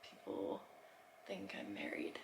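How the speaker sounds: hushed joking whisper; whispered aside. Pretend. Whispering a joke to the camera about the airport. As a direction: drop to a secret whisper.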